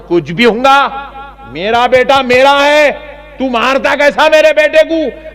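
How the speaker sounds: high, loud, slow indignant yell; mock angry parent. Preacher voices an angry neighbour's parent, loud indignant yell. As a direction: yell like a parent defending his son. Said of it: "انہوں نے بولے".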